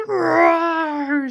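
high, drawn-out playful roar; lion roar. Pretend. Teacher playing a lion for children, high mock roar. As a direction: let out a long storybook lion roar. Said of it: "says the lion".

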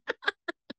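short mocking snicker; a little jeering chuckle; snickering laugh. A narrator's reading. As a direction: laugh at him quietly. Sidekick laughs at father.

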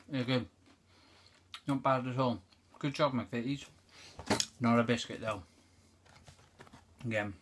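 chewing between words; talking while chewing. Real, not acted. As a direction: mouth full of biscuit. Seen 1: Ate biscuit before; hand to mouth, closed-lip chewing mouth shapes.

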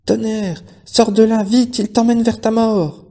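high anguished shout; terrible cry. A narrator's reading. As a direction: wail his name in terror. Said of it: "d'une voix terrible".

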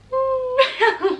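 high, loud giddy laugh; squealing happy laugh. Real, not acted. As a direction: burst into a bashful laugh. Laugh after reciting lyrics.

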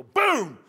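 sudden loud, high boom; imitated explosion. Preacher imitating an egg exploding in microwave, booming burst. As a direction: blurt it like a sudden blast.

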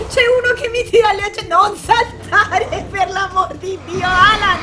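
high, loud frantic yelling in a fight; terrified gamer shrieking; panicked screaming. Real, not acted. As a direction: scream in real panic at the screen.

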